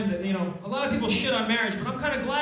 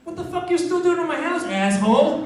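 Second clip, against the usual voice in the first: rude cat; 7 semitones higher, 6 dB louder than usual. Acted. Voicing the hostile cat.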